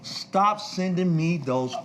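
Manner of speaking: high, emphatic mock executive; exasperated caller. Record executive phones persistent young promoter, exasperated caller. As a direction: imitate a worked-up caller, measured out phrase by phrase.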